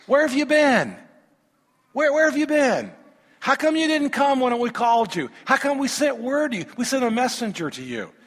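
acting out bewildered, accusing questions; confused reproach. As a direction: plead for an explanation, high-pitched at first. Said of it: "Can you imagine the confusion?".

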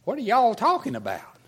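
put-on southern accent. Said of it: "he probably talked a lot like me".

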